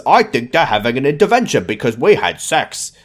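botched character voice sliding toward bad Australian; Toby. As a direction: attempt a goofy voice and lose it to a stray accent. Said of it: "I don't know how to do Toby voice anymore".

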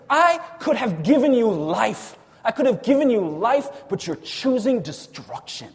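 loud, high, fervent sermon climax; impassioned preaching. Acted. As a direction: preach it with rising passion. Preaching builds to passion.

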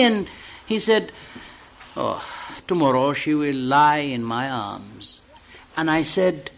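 recited dialogue between two friends; poem reading. Acted. Reader performs a poem of two friends, recital style. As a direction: read it as a staged exchange.

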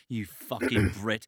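slow mock jab at a Brit; lightly hammed-up teasing; mock insult. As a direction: sneer it out slowly like a friendly insult. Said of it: "It's just like".

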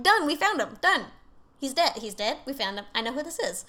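high, loud triumphant burst; excited rush. Retold or imitated. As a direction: blurt it like case closed. Investigators excited to close case.

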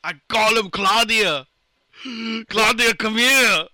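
slow, heavy Arnold impression; slowly, in a thick Austrian accent; Schwarzenegger voice. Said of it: "couldn't get a Schwarzenegger out".

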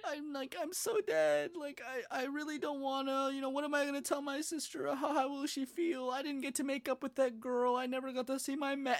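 high, whiny imitation of tearful lamenting; mock crying. Boy falling into a hole lamenting his life, high mock crying. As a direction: wail through his regrets in a hammed-up sob voice. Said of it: "and he's, like, crying".

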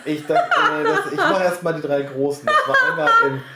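words broken by giggles; trying to talk through laughter; laughing. Real, not acted. Laughing while trying to tell.

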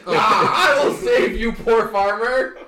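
mock-heroic cry. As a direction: declaim it like a rescuing champion, half laughing. Player acts out heroic rescue charge.

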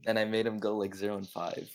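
ordinary delivery

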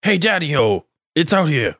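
lightly voiced greaser; a hint of a greaser; fifties teen rebel. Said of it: "make it sound like you're a greaser".

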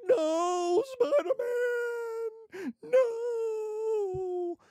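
high, slow, drawn-out wail of grief; mock crying. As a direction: wail it like a heartbroken fan, very high and stretched. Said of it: "you have to cry and be like".

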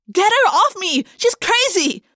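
high, loud cry for help; panicked shout. A narrator's reading. Jessica yelling while pinned on the table, loud panicked cry. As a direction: scream it as if pinned down. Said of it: "Jessica cried".

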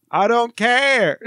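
high, drawn-out movie retort; fugitive's pursuer. Pretend. Lawman answering the fleeing suspect, theatrical retort. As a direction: call it out slowly and theatrically.

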